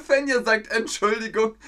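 high, laughing voice; high-pitched, on the edge of laughing; amused. Real, not acted. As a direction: read it out through real laughter. Reads chat message between laughs.

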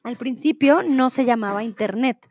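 ordinary talk, nothing marked

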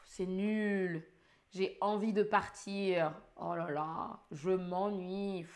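low, slow, weary and fed up; bored sigh. Shown as a demonstration. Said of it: "je vais imiter".

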